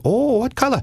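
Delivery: high, sing-song creepy caller voice; odd prank caller. Retold or imitated. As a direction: play the weird caller way up high. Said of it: "every night I would say".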